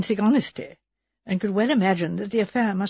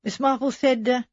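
plain reading voice

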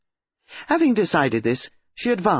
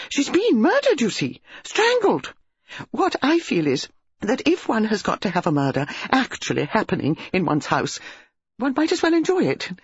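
high, gushing country lady; excited hostess; 7 semitones higher than usual. A narrator's reading. Mrs Bantry telling Jane about the body, high gushing. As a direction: rattle on, thrilled and scandalised.

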